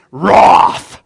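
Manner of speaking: "wrath" loud, with a broad open "rawth" vowel; big-sounding "wrath". Shown as a demonstration. Preacher imitating cooler preachers, "wrath" as a big "rawth". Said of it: "they say the word wrath like this".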